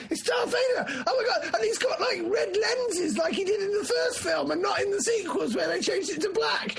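breathless high-pitched geeky squealing; mock-hysterical fan rattling off details; screaming fan. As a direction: gush in a hammed-up inner scream. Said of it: "Your head is screaming".

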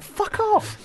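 very high, hammed-up brush-off; mock rejection. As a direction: squeal a mock-outraged rejection. Imagined rejection of pickup line.

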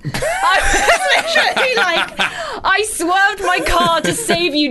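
laughing while speaking high and slow. Real, not acted. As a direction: laugh through the words, high. Mock outrage at the Jeep.

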